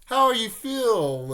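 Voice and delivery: loud, drawn-out, high-pitched taunt; mock-teasing. Naruto needling Kiba about his new family, loudly teasing. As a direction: stretch it out to needle a friend. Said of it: "like, makes fun of".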